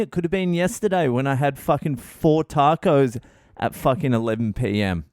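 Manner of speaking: normal talking voice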